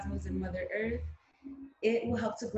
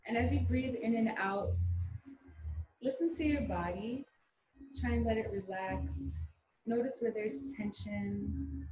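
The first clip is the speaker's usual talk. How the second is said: calm meditation guiding with long pauses; guided meditation. Acted. Guide asking group to close eyes and breathe, meditative. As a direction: ease listeners into the breath, phrase by phrase.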